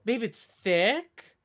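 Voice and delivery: ordinary talk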